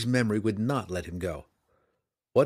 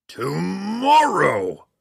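drawn-out, very loud, much higher roar; dragon roar; 8 semitones higher, 9 dB louder, much slower than usual. A narrator's reading. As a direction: bellow the word like a furious red dragon. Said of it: "the dragon roared".

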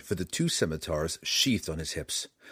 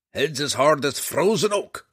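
grumbling dwarf, higher and louder; gruff gravelly dwarf voice; 6 semitones higher, 6 dB louder than usual. A narrator's reading. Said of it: "grumbled the dwarf".

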